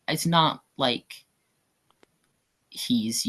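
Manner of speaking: normal talking voice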